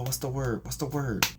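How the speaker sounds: plain reading voice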